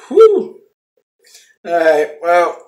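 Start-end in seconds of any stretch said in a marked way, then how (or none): 0.1-0.6 a high, loud whoosh of breath as the dip burns; sharp high whew. Real, not acted. Reacting to the strong dip to viewers, sharp high whew. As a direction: blow it out high, like the burn just hit.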